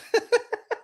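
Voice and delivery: short high burst of laughter; high cackle. Real, not acted. Laughing at his own boast to viewers, a high cackle.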